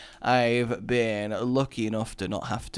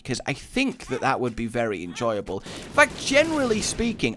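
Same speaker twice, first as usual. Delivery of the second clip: ordinary talk, nothing marked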